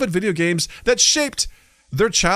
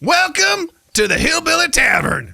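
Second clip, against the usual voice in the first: high, loud hillbilly Southern twang; big high-pitched Southern accent; 5 semitones higher, 3 dB louder than usual. Shown as a demonstration. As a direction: ham up a Southern accent on request. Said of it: "southern, southern accent".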